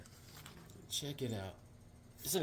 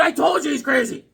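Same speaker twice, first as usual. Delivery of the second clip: exasperated retort; 9 semitones higher than usual. Retold or imitated. Acts out own exasperated reply.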